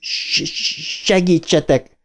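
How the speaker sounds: high-pitched stuttered plea; halting cry for help; stammered plea. Acted. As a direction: hiss on the first sound before begging. Stuttering, crying boy asks help.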